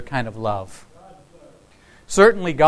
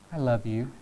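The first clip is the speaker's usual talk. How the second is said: a softer I love you, gazing into someone's eyes; tender date confession; 4 semitones lower, 7 dB quieter than usual. Pretend. A date confessing love to someone, softly. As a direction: lean in and say it softly, like a sincere confession. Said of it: "in a poignant moment".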